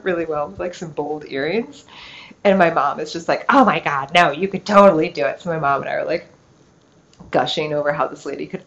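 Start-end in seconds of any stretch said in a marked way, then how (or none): 3.5-5.4 excited mom. Retold or imitated. Mom gushing about bold earrings.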